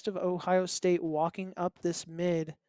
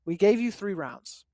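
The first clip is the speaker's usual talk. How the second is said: firm, loud opening that eases off; lightly voiced hard bargainer, joking; mock negotiator; 6 dB louder than usual. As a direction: pretend to lay down terms to an opponent. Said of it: "you sounded like there".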